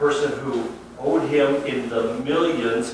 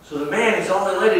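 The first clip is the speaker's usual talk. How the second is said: preaching; 6 semitones higher, 9 dB louder than usual. Acted. Pastor marvels at the king's mercy.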